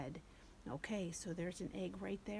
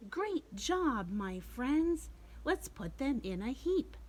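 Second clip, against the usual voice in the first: higher, louder bunny cheering; 5 semitones higher, 5 dB louder than usual. Acted. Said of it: "Bunny says".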